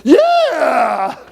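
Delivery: loud, high yell with a laugh; cheering shout. Shown as a demonstration. Speaker shows the crowd the yeah she wants, shouting and laughing. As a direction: whoop it like rallying a crowd. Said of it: "Can I get a yeah?".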